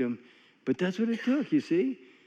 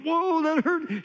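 much higher, slow startled cry of pain; wife yelping; 12 semitones higher, slower than usual. Retold or imitated. Wife voiced after test swat, high startled slow yelp. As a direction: squeal it like the sting surprised you.

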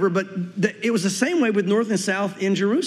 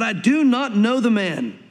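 "I do not know the man" slow, each word weighted; emphatic oath; 2 semitones higher, slower than usual. Retold or imitated. Peter denies Jesus with an oath, "I do not know the man" slow and emphatic. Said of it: "he denied it with an oath".

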